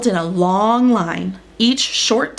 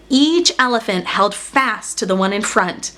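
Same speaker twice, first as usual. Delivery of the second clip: ordinary delivery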